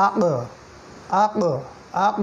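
আক with a back "q" released into a short echo; double sound. Shown as a demonstration. Teacher drills qaf sakin, echoing final consonant on আক. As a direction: bounce the end of আক, "aq-o". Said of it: "double sound হবে".

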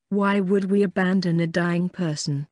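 ordinary delivery, nothing marked

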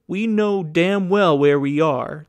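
loud, slow, hard retort; angry retort. A narrator's reading. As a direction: bite off each word slowly. Max snapping back, louder.

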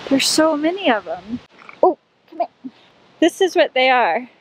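normal talking voice

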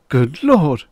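a startled, loud, high cry; shocked exclamation. A narrator's reading. Archaeologist jolted back from the telescope, shocked cry. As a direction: recoil in horror at what you see. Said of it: "He leapt violently back".

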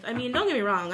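normal talking voice

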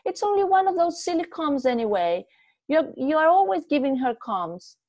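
high, loud, rushed pleading; wife begging her husband to leave it, high and loud; frantic pleading. A narrator's reading. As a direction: talk him out of going back, desperate. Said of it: "she cried".

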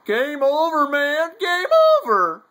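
loud, very high panicked yell; Hudson impression. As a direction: scream it like a movie soldier losing his nerve. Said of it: "You know him as, as, uh, Hudson".

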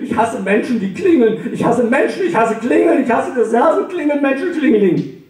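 high, rising furious tirade; shrill-pitched hateful rant at the doorbell; angry rant. Acted. Grumpy neighbour rants at newcomer.

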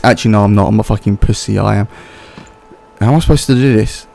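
ordinary talk, nothing marked

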